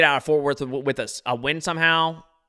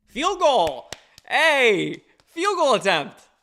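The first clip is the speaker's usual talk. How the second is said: higher, louder sports announcer voice, stretched out; mock announcer call; 10 semitones higher, 3 dB louder, much slower than usual. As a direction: call it like a hyped stadium announcer. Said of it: "See, now I'm being entertaining".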